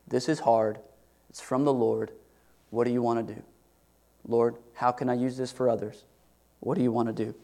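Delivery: soft, hushed surrender; quiet accepting prayer. Acted. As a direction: pray it softly, embracing the hardship.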